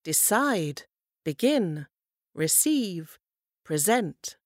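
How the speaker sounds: "Decide, begin, receive, present", each stressed on the final syllable; light first syllable, strong second in "Decide, begin, receive, present"; last-syllable stress. Shown as a demonstration. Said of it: "sur la dernière syllabe".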